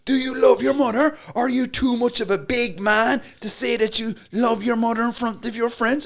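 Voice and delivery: high, loud taunting challenge; aggressive sales pitch. Acted. Salesman taunting the gang leader, loud high goading. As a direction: goad him in front of his mates. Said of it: "aggressive pitch".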